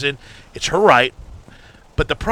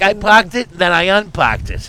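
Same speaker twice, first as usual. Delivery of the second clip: louder Boston drawl, "unparked" slowed; imitating the ad in a Boston accent; 3 semitones higher, 3 dB louder than usual. Quoting the Hyundai ad in a Boston accent. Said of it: "talking in Boston accent".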